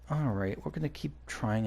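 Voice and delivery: normal talking voice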